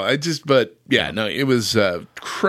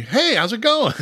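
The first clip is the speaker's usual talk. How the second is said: mock cheerful father; 10 semitones higher than usual. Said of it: "And then immediately".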